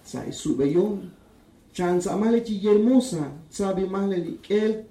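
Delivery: ordinary talk, nothing marked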